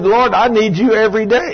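high, urgent acted pleading; imitated urgent prayer; desperate plea. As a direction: plead it up high like a man in trouble. Retold desperate answer to God.

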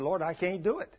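acted reluctant priest; pleading refusal. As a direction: back out of it, pleading. Priest refusing God's order.

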